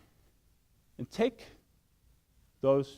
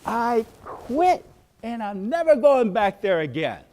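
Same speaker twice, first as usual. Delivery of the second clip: loud, higher defiant outburst; angry quitting; 6 semitones higher, 10 dB louder than usual. Pretend. Worker quitting in private, loud defiant shout. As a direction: slam it down and shout you quit. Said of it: "slam it down. Go".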